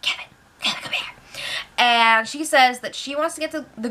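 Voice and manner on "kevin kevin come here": hushed whisper calling him over. Retold or imitated. Ika secretly calls Kevin over, whispering. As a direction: whisper his name so nobody hears.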